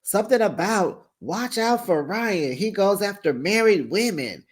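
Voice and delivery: lightly voiced Tamara quote; imitating Tamara. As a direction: play Tamara setting the record straight. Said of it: "Tamara says".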